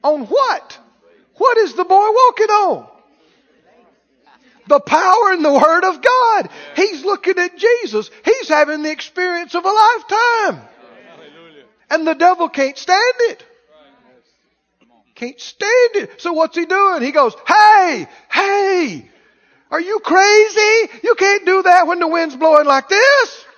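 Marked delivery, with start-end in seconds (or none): 1.4-10.6 high, loud preacher's proclamation; fervent preaching. Real, not acted. Preacher exulting to the congregation over Peter on the water, fervent. 17.5-23.4 high, loud, frantic heckling; hammed-up panicked shouts to distract; desperate devil. Devil heckling Peter on the windy water, desperate. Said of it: "hear the desperation in the devil's voice".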